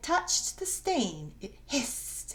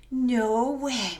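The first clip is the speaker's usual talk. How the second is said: stunned disbelief; 4 semitones higher, 6 dB louder than usual. Acted. Malia watches the dime shrink away.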